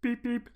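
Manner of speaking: small high-pitched beep-beep; bus beep. Pretend. Host pretending to be the camp bus, high beep. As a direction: squeak out a little vehicle horn.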